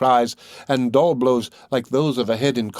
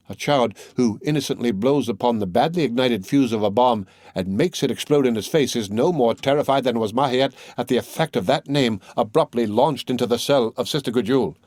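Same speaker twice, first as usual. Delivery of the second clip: plain reading voice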